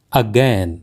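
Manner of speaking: again said as "a-GEN"; correct pronunciation. Shown as a demonstration. Teacher gives learners the correct word, carefully pronounced. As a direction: say again with a short e, falling. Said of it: "इसका सही उच्चारण है".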